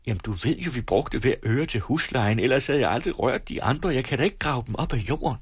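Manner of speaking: high-pitched, hurried protest at the end; pleading mother. Acted. Wife explaining the rent money to her husband, pleading.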